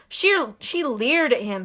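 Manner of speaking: ordinary delivery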